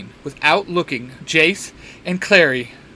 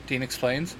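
plain reading voice

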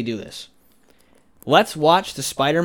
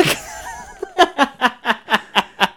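laughing; 9 semitones higher than usual. Real, not acted. Laughs after joke impression.

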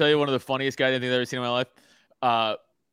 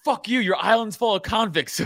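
retold drunk insult; 9 semitones higher, 4 dB louder than usual. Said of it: "And he's like".

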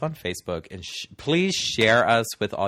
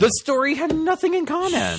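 high mock wail; 3 dB louder than usual. Mock dramatic complaint.

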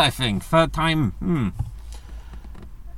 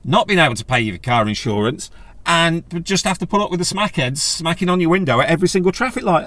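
normal talking voice

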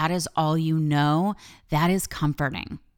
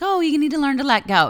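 high, louder mock of breezy advice; a teasing imitation of people telling her to relax; 11 semitones higher, 5 dB louder, faster than usual. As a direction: parrot everyone's glib advice in a high sing-song. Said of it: "everybody's like".

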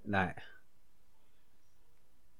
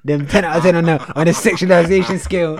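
loud teasing; 6 semitones higher, 13 dB louder than usual. Real, not acted. Teasing friend loudly.

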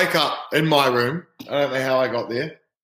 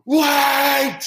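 urgent call; 11 semitones higher than usual. Retold or imitated. Rookie calling the manager back, high urgent shout. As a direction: stop the manager walking off. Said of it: "I'm like".

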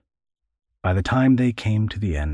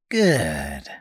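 lower, gloating creaky voice; cruel old man; 3 semitones lower than usual. Acted. Old man gloating at boy's pain, creaky. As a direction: savour it with an evil grin.

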